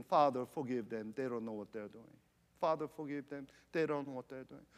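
low, soft repeated plea; voicing a dying prayer, softly and low; hushed prayer. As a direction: drop into a quiet, subdued prayer. Voices Christ's repeated prayer on the cross.

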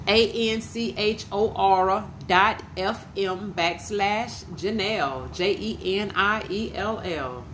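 slow, letter by letter; spelling out. Real, not acted. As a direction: spell the address out slowly for listeners. Spelling out a web address.

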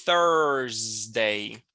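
"Thursday" low and drawn out, starting on TH; TH sound. Shown as a demonstration. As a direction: stretch the "Thurs" in "Thursday", then add day. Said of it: "Seria mais ou menos assim".

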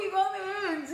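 high sing-song mock reply; chirpy reply. Answering the nurse in a high sing-song voice. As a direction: sing it back sweetly. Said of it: "E eu".

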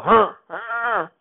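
put-on high, loud sulky huffing like a child; childish huffs. Goldfinger sulking like a child who lost, high loud huffs. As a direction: huff like a kid stomping after losing a game. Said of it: "they stomp their feet and go".

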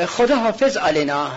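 high, hurried goodbye; jittery farewell. Acted. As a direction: get out of there fast. Hasty nervous farewell.

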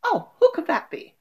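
a light, high voice answering the door; a stranger hearing a knock. Acted.

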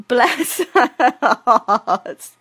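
real laughter through drawn-out words; laughing fondly. Real, not acted. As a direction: crack up while saying it. Teller laughs at her own story.

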